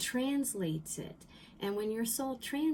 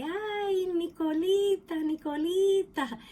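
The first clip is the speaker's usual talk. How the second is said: loud, much higher grandmother calling out; grandma's intonation; 10 semitones higher, 7 dB louder than usual. Retold or imitated. Grandma calling her grandchild by pet name, high loud call. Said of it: "she used to say".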